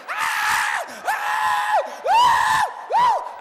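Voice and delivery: full-voiced acted shrieks; repeated high scream. Mom on the ground keeps screaming, high and loud. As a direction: scream over and over. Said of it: "She's screaming".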